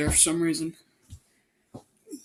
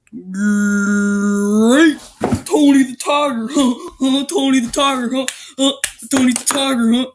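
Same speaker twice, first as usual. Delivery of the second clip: loud, higher, hammed-up chant of Tony the Tiger, huh; goofy cartoon voice; 4 semitones higher, 6 dB louder than usual. Reader riffing a silly Tony the Tiger bit, hammed up. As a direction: bounce it like a cereal ad.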